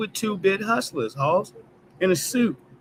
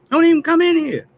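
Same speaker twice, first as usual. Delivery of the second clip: much higher, louder, yelled at the politicians; angry shout; 12 semitones higher, 8 dB louder than usual. Real, not acted. Telling pandering politicians to stay out, yelling. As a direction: blow up and yell it at them.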